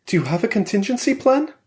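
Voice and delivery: a wary wife pressing her husband; Valeria's voice. A narrator's reading.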